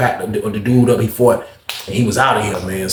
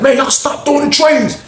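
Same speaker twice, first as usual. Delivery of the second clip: high, laughing mock yell; high yelling kitchen worker; 14 semitones higher than usual. Kitchen worker yells at inmates throwing trays, in a high mock yell. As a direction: squeal it out like a fed-up worker.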